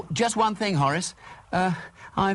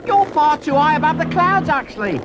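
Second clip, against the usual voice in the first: loud, raised-pitch instructions; urgent calling out; 4 semitones higher, 6 dB louder than usual. Acted. Sam guiding the lost pig down, loud calling. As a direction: call across the sky to a lost pilot.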